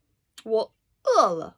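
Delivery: "我饿了" said loud as a model phrase; Chinese phrase. Shown as a demonstration. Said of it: "I'm hungry".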